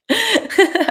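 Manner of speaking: high, loud laugh; high burst of giggling; evasive laugh. Real, not acted. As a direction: laugh off an awkward question. Laughs, dodging a question.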